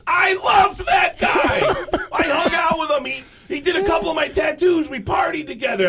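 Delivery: hammed-up despairing cry; high anguished wail. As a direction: wail about the betrayal. Drummer wails about friend.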